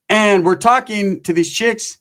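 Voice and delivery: ordinary delivery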